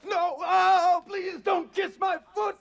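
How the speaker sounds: high, loud, slow yelp of alarm; shrieking protest as she falls at his feet; panicked shout. Acted. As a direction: recoil and cry out to stop her.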